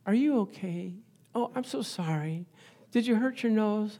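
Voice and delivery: soft, cooing sympathy, imitating the wife; baby them gently, slightly hammed-up; tender mock fussing in a hushed voice; softest baby talk. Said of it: "she gave me the softest, babyest".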